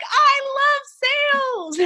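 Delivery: high, slow, drawn-out gushing; a client swooning over sales. Retold or imitated. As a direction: sing out your love for sales. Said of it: "they're like".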